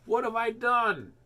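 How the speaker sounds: high, loud, slow anguished cry; distraught cry. A narrator's reading. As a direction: cry out in guilt and fear. Toad panics, Frog vanished.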